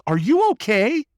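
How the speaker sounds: lightly voiced stranger, high and slow; loud, drawn-out mock check-in; concerned stranger. Trainer checks on a man hiding in back, lightly acted concern.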